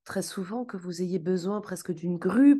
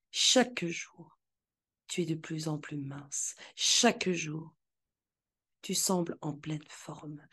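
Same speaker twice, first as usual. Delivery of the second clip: guided visualization. Acted.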